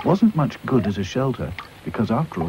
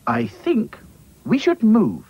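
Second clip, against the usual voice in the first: Dotty's voice; 13 semitones higher, 5 dB louder, slower than usual. Acted. Dotty's proposal.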